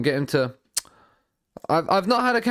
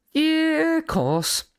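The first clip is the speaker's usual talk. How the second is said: put-on failed Scouse accent; 12 semitones higher, much slower than usual. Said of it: "I can't do a Scouse accent".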